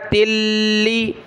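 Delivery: तिल्ली with a long held l; taa joined to laam. Shown as a demonstration. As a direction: glide from t straight into the ल्ल of तिल्ली. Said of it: "ता को लाम के साथ मिलाया".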